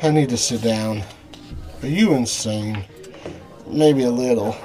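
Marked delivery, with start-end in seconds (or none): none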